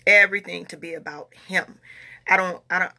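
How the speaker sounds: normal talking voice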